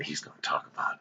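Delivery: soft unvoiced aside; a conspiratorial whisper. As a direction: lean in and whisper it. Aside to viewers.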